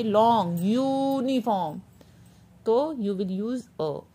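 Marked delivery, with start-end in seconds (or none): 0.7-1.8 यूनिफॉर्म with the first YOO held long; long U demo. Shown as a demonstration. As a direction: stretch the opening of यूनिफॉर्म, then finish normally. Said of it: "long sound अगर यू की आए". (यूनिफॉर्म का यू लंबा खींचकर)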